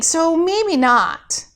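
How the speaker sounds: slow doubtful maybe not; uncertain. Acted. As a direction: drag it out unsure. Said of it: "I'm in training".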